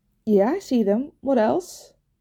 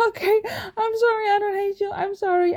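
whimpering; 10 semitones higher than usual. Acted. Whimpering apology.

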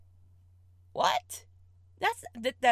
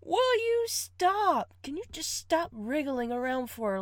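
exasperated plea; 7 semitones higher, 3 dB louder than usual. Acted.

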